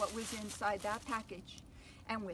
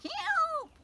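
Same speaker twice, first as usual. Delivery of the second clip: very high, loud shriek; 9 dB louder than usual. Acted. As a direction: shriek for help. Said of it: "shrieked Rosie".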